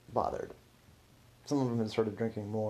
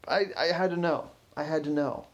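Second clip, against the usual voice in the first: pleading, slightly raised voice; desperate confession; 6 semitones higher, 4 dB louder than usual. Acted. Caught tech guy confessing to the curator, pleading. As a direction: insist on it, caught and desperate to explain. Culprit confesses under questioning.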